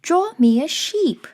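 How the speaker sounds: high, insistent little story voice; odd little voice. Acted. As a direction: repeat the request in a loud, small high voice. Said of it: "awakened by an odd little voice".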